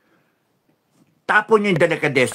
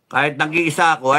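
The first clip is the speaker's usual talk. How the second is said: normal talking voice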